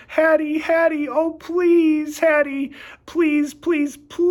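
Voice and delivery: high, loud pleading whine; whining plea. Acted. Dog pleading with Hattie for food, high whine. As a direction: beg her over and over in a puppy whine. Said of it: "I whine some more".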